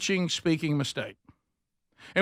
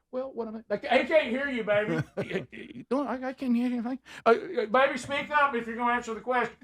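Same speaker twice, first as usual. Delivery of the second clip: mock wife; 6 semitones higher than usual. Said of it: "and then".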